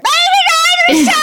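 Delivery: very high imitation of a child's scream; screaming toddler. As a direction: shriek it like a kid in a nightmare. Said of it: "She's like screaming".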